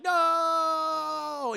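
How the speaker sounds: long, high, loud villain's "no"; mad mock bellow. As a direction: hold the furious yell, hammed-up. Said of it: "He's very mad".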